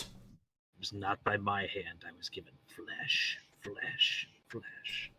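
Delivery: slow eerie whisper; creepy whisper. Acted. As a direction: whisper it like a ghoul. Creepy creature line whispered.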